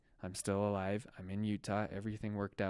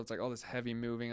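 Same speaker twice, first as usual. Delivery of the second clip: usual speaking voice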